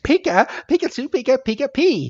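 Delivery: very high, chirpy creature voice; Pikachu. Retold or imitated. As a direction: squeak out cheerful little creature chatter. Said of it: "Pikachu is like".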